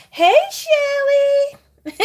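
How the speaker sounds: high, slow, stretched greeting; calling out to a friend in chat; drawn-out happy call. Real, not acted. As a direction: sing the name out long and bright. Excited greeting to a viewer.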